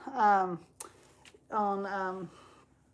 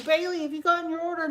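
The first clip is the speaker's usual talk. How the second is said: high, fast, urgent question; imitating a hurried call to a friend; frantic phone call; 6 semitones higher, faster than usual. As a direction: rush it out in a mock panic. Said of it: "I call Bailey".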